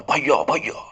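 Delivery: acted yell for big brother; dramatised cry for help; retold shout. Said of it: "ज़ोर से चिल्लाता है".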